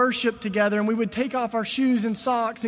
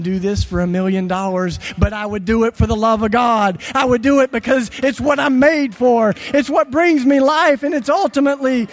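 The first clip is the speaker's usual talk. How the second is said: full-force preaching; 7 dB louder than usual. Acted. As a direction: hammer the point home to the crowd. Preaching climax.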